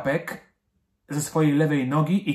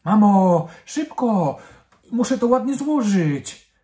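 put-on loud, high, impatient child's call; urging, hurrying on; 8 semitones higher, 8 dB louder, slower than usual. A narrator's reading. Girl rushing mum to hand over clothes, urging loudly. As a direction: call out insistently, pitched high. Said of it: "Madzia popędzała mamę".

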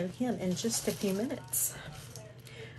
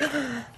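sharp gasp; 5 semitones higher than usual. Real, not acted. Spotting a new gift item.

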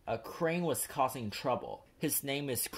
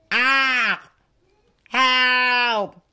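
very high, loud terrified cry; panicked scream for help; 11 semitones higher, 14 dB louder than usual. A narrator's reading. Trapped engines scream for help, terrified. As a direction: scream as the ship hits. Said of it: "called the engines".

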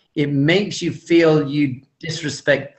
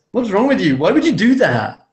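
higher, louder mock snap of blame; mock accusing snap; 6 semitones higher, 4 dB louder than usual. Imitating how not to scold his wife, a higher, louder accusing snap. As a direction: throw the blame at your wife with attitude. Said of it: "with that attitude and that face".

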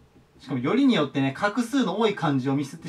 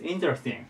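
interesting with the weight on "in"; stress on the first syllable; 4 semitones lower, 8 dB quieter than usual. Shown as a demonstration. Teacher showing students the accent on interesting's first syllable. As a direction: hit the opening "in" of interesting. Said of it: "最初にアクセントがきますね".

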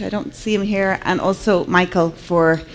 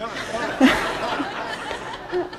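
soft breathy laugh; 7 semitones higher, 6 dB quieter than usual. Real, not acted. Laughs after the story.

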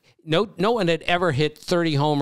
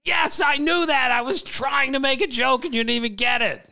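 exasperated shout; 9 semitones higher than usual. Acted. Host shouting his reply at the Twitter critic, exasperated.

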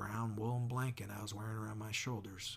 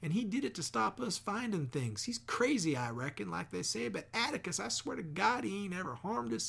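much higher, rushed and pleading; frantic pouring out; 10 semitones higher, 4 dB louder than usual. A narrator's reading. Jem begging his father, high frantic plea. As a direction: spill every secret in a panic to save him. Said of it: "He began pouring out our secrets".

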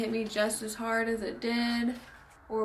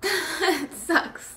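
laughing; 6 semitones higher, 5 dB louder than usual. Real, not acted. Repeated with a laugh.

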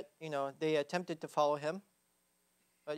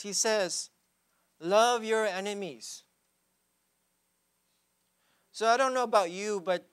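preaching; 5 semitones higher, 6 dB louder than usual. Real, not acted. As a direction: preach it with full conviction. Preaching at full force.

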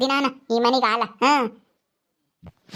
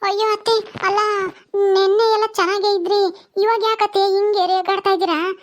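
dismayed protest; 5 semitones higher than usual. Acted. Daughter-in-law asks mother-in-law why she is snapping, dismayed protest. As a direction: plead upward against the scolding.

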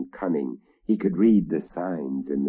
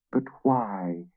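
pleading; 5 semitones higher, slower than usual. Acted. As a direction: beg her for an answer. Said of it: "I pleaded".

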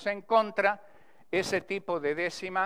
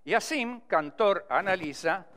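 declaimed cordel verse, strong measured opening; verse recitation; 3 semitones higher, 4 dB louder, slower than usual. Shown as a demonstration. Teacher reciting the next stanza of his cordel, raised declamatory verse. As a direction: recite each line with weight and a pause after it. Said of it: "recitando para vocês".

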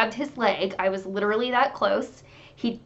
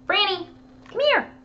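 high, sing-out call to a pet; calling the dog; 10 semitones higher than usual. Real, not acted. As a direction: coax the dog over in a very high voice. Calls her pug over.

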